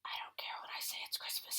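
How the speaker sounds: unvoiced whispered aside. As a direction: whisper it under breath.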